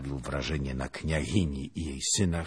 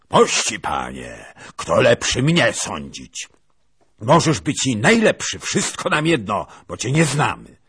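much higher, loud contemptuous retort; haughty scorn; 8 semitones higher, 13 dB louder than usual. Acted. Princess scorns the lieutenant, high and contemptuous. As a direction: sneer down at the stranger.

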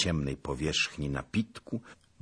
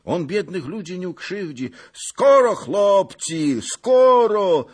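put-on high, loud, eager old man; rally musicians and lads; shouted orders; 13 semitones higher, 9 dB louder than usual. A narrator's reading. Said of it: "zakrzyknął na muzykę". (radosne, głośne nawoływanie)

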